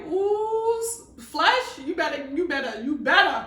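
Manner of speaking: loud scolding rebuke of the flesh; rebuking her flesh. Retold or imitated. Rebuking her own weak flesh, loud and stern. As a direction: preach it at the flesh, loud and fired up. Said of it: "There's times I'll be like".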